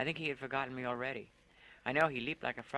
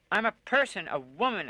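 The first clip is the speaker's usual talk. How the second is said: loud, much higher furious rave; raving; 7 semitones higher, 10 dB louder than usual. Acted. Woman raves at Lone for using her, loud furious cry. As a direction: hurl it at him in a rage. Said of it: "I raved at him".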